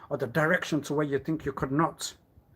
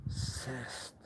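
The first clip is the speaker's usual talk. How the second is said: slow, then a whispered echo of sliced; slow grim whisper; 11 dB quieter, much slower than usual. Acted. Describing his wound, slow hushed whisper. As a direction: let sliced fade to a breath.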